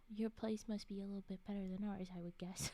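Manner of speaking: soft whispered quip; hushed, unvoiced joke. Real, not acted. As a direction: whisper the wry remark. Whispered aside after quote.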